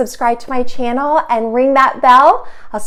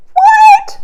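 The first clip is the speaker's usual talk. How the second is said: playful what; 9 dB louder than usual. Acted. Teasing pause before rhino's name.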